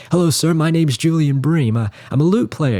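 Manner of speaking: put-on young [name]. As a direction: imitate a keen young musician greeting his idol. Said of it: "of a young [name] going up to the".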